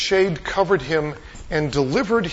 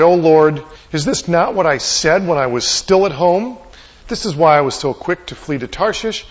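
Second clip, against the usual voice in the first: loud, slightly raised, mildly indignant complaint to God; acted angry appeal, pushed louder; angry prayer; 4 semitones higher, 6 dB louder than usual. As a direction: pray it as a forceful protest. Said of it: "He's angry at God".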